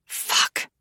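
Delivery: breathy whispered fuck; whispered self-reproach after a jealous slip; whispered curse. Acted. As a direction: curse under your breath in regret. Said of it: "I hate that I said it".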